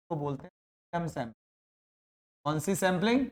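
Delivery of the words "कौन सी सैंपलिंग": ordinary talk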